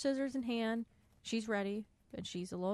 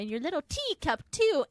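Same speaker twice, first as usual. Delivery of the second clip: higher, louder witchy taunt; a hammed-up witch threat; mock Wicked Witch; 7 semitones higher, 7 dB louder than usual. Henry taunting Belle like the Witch, higher and louder. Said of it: "this time he'll say".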